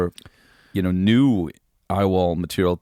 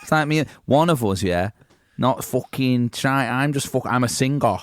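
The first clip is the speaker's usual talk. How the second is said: [name]; 5 semitones higher than usual. Pretend. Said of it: "Can you keep doing Liam".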